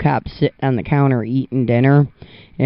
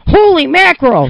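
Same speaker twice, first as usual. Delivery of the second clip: high, loud startled cry of holy mackerel; shocked exclamation; 13 semitones higher, 8 dB louder than usual. Acted. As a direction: blurt it out in shock. Joke punchline, shocked counter guy.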